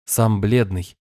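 usual speaking voice

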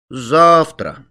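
usual speaking voice

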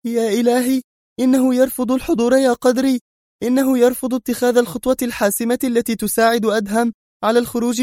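very high, strained woman's voice; extremely tense. Acted. Mona telling Qadri the battle is lost, very tense. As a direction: lament in alarm, pitched right up. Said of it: "قائلة في توتر بالغ".

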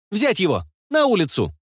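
put-on high boy's voice giving orders; bossy kid barking orders; child's command. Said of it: "раз голос не поломался".

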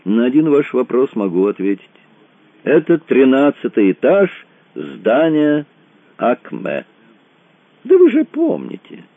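high, merry chairman's voice; jovial chairman. A narrator's reading. Jovial chairman answers Randall.

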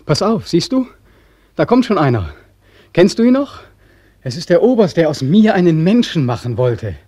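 high, loud and urgent; agitated cry. Acted. Beckmann showing the other voice the passing Oberst, loud and high. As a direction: point him out, voice climbing.

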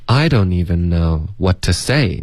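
main stress on "say", falling at the end. Shown as a demonstration. Teacher modelling intonation for a student, stress on say. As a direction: land on "say", leave the rest unaccented. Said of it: "say是重音".

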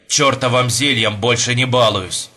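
ordinary delivery

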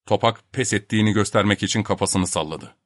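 plain reading voice

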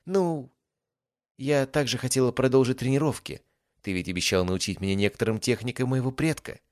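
a pleased trader showing off her goods; lightly voiced cheerful young merchant girl. Song asks for training.

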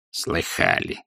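low-key curmudgeonly grumble; Скрудж. A narrator's reading. Scrooge answering his nephew, quiet grumbling. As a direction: grumble it softly and curtly. Scrooge's curt gruff reply.

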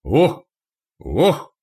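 short, high, forceful "oh"; effortful exhale. Acted. Archers loosing arrows, effortful exhale. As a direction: exhale hard with each shot. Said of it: "выдыхали, посылая стрелы лучники".

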